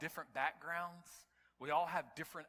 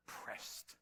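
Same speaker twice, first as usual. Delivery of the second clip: whispered pressed; 3 dB quieter than usual. Real, not acted. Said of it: "emphatic whispered word".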